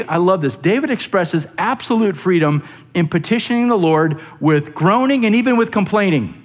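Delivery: usual speaking voice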